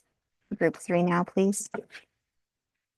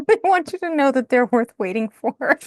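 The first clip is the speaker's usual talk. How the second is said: delivering the message through giggles; higher and louder, cracking up; laughing at the card's message; 5 semitones higher, 4 dB louder than usual. Real, not acted. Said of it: "I just am cracking up".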